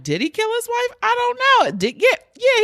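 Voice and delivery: squeaky mock debate with yourself; mock high-pitched wondering. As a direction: play it up like you're torn over a mystery. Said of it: "I'm like".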